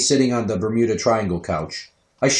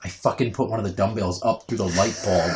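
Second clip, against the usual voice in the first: usual speaking voice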